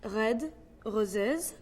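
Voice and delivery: "Red roses" with uvular French R's. Shown as a demonstration. Showing the R only used in French, French R in "Red roses". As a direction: roll the R's of "Red roses" back in the throat, French style. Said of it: "R's are distinctly very French".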